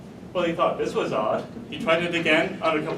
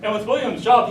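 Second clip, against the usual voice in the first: ordinary delivery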